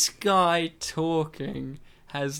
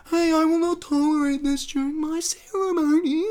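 much higher, pompous put-on voice; mock antagonist; 11 semitones higher than usual. Host quotes the rich antagonist in a high mock voice. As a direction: play a snooty rich villain, high and haughty. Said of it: "he's like".

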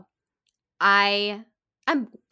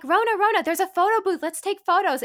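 high, eager, louder call of friends; friends coaxing; 9 semitones higher, 5 dB louder than usual. Retold or imitated. Friends urging crying Rona to take photos, high and eager. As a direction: lure her to the photo booth, bright and high. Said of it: "My friends were like".

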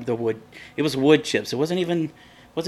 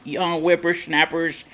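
put-on old man voice; 5 semitones higher, slower than usual. Grumpy old man phrase.

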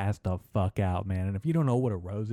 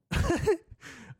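high laugh. Real, not acted. Laughs at own impression.

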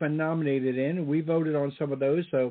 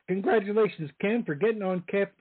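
ordinary delivery

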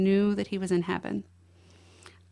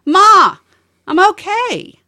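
higher and louder, calling from the other side of the house; 12 semitones higher, 13 dB louder, slower than usual. Retold or imitated. Son calling from the next room, louder and higher. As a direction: call it out the way he did from another room. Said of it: "he'd get louder".

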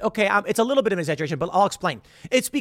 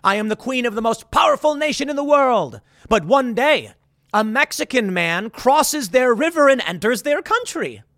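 higher, lightly hammed-up regal queen voice; mock Queen Ramonda; 6 semitones higher, 3 dB louder than usual. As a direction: play a haughty queen, lightly mocking. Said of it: "that's what Queen Ramonda says".

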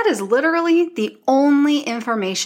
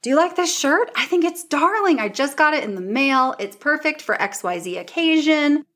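higher, bubbly story talk to followers; chatty influencer voice; 6 semitones higher than usual. Shown as a demonstration. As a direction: sell it like a friendly girlfriend. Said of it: "I would say".

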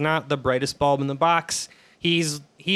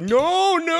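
mock Chris Rock; 13 semitones higher than usual. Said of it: "Michael Scott doing the Chris Rock impression".

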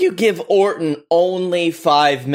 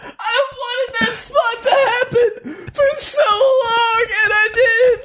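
crying laughing. Real, not acted. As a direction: laugh until you cry. Laughing so hard he cries.

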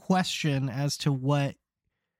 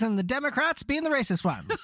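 ordinary delivery

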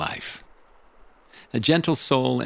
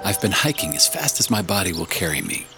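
audiobook narration. Acted. Reads audiobook chapter.

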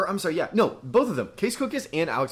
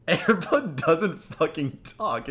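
slow, higher-pitched, exasperated correction; exasperated insistence; 4 semitones higher, much slower than usual. Retold or imitated. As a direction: spell it out in mock outrage, word by word. Retells his exasperated second retort.

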